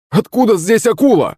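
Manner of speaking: high-pitched alarmed exclamation; startled cry. A narrator's reading. Servant spotting a shark by the boat, crying out. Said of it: "вскрикнул слуга".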